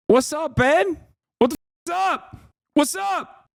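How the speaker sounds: high-pitched goading call; high mock taunt. Streamer taunting Ben over the debate, high-pitched goading. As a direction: needle him in a squeaky high voice.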